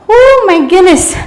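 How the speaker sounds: exhausted gasp. Real, not acted. Relief after burning hold.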